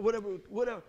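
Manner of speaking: ordinary delivery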